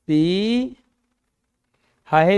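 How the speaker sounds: ordinary delivery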